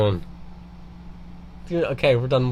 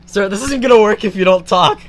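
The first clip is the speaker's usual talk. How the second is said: higher, louder call to Sarah; exasperated call; 8 semitones higher, 5 dB louder than usual. Real, not acted. Calling out silent cohost.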